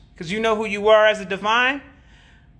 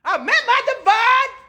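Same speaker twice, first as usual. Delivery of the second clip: mocking laugh; 13 semitones higher than usual. Mocking his quoted claim.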